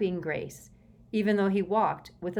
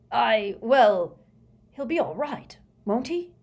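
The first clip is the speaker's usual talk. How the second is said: higher, hesitant and mildly anxious; 5 semitones higher, 7 dB louder than usual. A narrator's reading. Prime Minister asks Scrimgeour about Chorley, mildly anxious. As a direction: stumble in, then plead for reassurance. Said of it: "said the Prime Minister anxiously".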